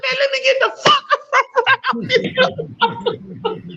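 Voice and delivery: high, slow, laughing through a retold yell; laughing mock yell. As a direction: laugh while playing yourself wanting out. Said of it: "I said".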